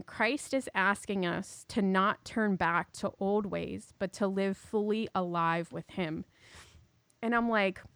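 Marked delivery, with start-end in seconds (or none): 0.0-6.2 slow dictation. Shown as a demonstration. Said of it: "so I'm gonna repeat it again".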